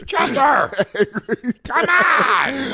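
calling out. Pretend. Friend mock calls Chester, laughing shout. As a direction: yell for Chester, half laughing.

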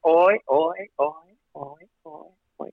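ois fading away to nearly nothing; imitated ping-pong echo. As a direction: mimic an echo trailing off, each oi fainter. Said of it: "what we call a ping pong echo".

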